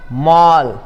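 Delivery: "मॉल" with an open aw; in-between vowel. Shown as a demonstration. Teacher modelling a second example, "मॉल" with aw. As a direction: sound "मॉल" between maal and mol. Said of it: "उसी तरीके से".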